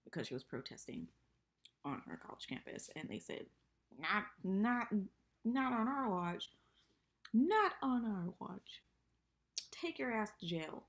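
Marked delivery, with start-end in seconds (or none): 4.0-10.8 high, slow mock scolding; hammed-up stern authority; mock stern official. Retelling officials arresting Emily.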